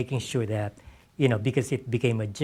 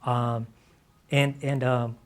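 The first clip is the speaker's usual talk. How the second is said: normal talking voice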